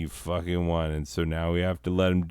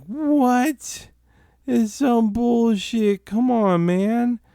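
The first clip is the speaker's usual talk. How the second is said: high, loud, stretched-out disbelief; indignant yelp of protest; outraged protest; 5 dB louder, slower than usual. Retold or imitated. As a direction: whine it at the top of your voice. Outraged at being sued.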